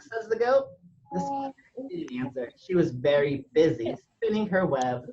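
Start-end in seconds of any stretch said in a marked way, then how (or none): none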